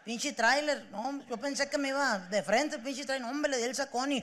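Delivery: lightly voiced grieving guy; teary [name].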